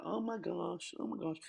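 very high squeal of panic; frantic oh my gosh. Retold or imitated. Comic recalling a chaotic day, squealing high in panic. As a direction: shriek it like everything's going wrong.

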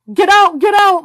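very high, loud frantic yell; shouting. A narrator's reading. Marla yelling at the monitor, frantic shout. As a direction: scream the warning at the screen. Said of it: "Marla shouted".

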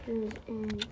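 ordinary talk, nothing marked

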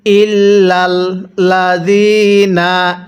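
ইল্লাল্লাযিনা chanted slowly, lam doubled, ya stretched one alif; tajweed recitation. Shown as a demonstration. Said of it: "পড়ুন".

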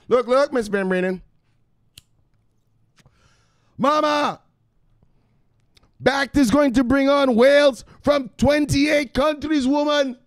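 high, loud, exasperated son voice; son yelling at mama. Pretend. Son shouting crypto news back at his mama, high and exasperated. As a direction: wave her off like a dramatic grown son.

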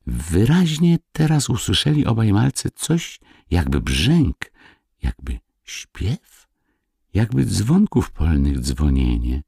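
ordinary delivery

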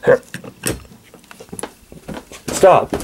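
ordinary delivery

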